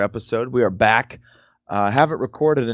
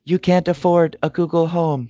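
high, sassy assistant voice; mock the phone talking back; voicing the phone assistant; 8 semitones higher than usual. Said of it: "She said".

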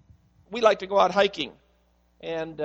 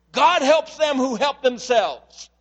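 slow, louder, higher pompous quote; hammed-up preacher proclaiming a saying; mock preacher; 6 semitones higher, 7 dB louder, slower than usual.

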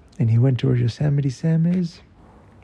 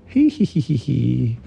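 put-on mischievous child's "hee hee"; giggling. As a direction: snicker like a kid hiding a secret. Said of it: "Tail kept laughing".

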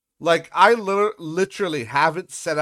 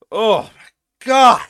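slow, louder drawn-out groan; exasperated; 7 semitones higher, 10 dB louder, much slower than usual. Real, not acted. Griping about usernames, slow exasperation. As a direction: stretch it out, fed up.

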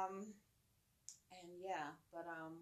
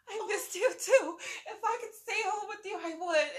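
much higher, louder doting mom voice; gushing mom; 9 semitones higher, 8 dB louder than usual. Retold or imitated. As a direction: gush over him like he's the whole world. Mom answering her little son.

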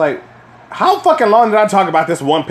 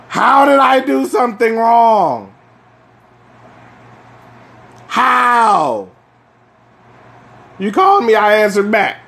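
exasperated shout; 7 semitones higher than usual. Real, not acted. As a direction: shout it out like it's so unfair. Ranting about unfair parent scolding.

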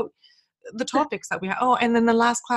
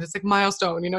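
ordinary talk, nothing marked

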